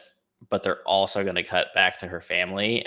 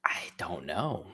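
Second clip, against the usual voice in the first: whispered; 8 dB quieter, slower than usual. Real, not acted. Unsure whispered reply.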